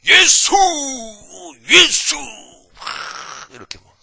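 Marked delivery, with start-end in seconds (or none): 0.1-2.2 much higher and louder, repeated shouted cries; booming prayer cry. Retold or imitated. A fervent believer in prayer, shouting Jesus' name. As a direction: cry Jesus out twice, ringing loud. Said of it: "막 우렁차요".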